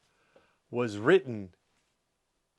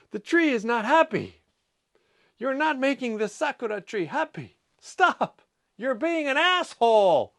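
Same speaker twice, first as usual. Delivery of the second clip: much higher and louder, hammed-up indignation; mock outraged scolding; 12 semitones higher, 7 dB louder than usual. To someone shaking the sakura tree, a hammed-up loud scolding. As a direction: shout them down on the tree's behalf, playfully. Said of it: "I would say something like that".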